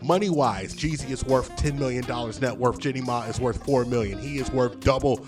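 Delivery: ordinary delivery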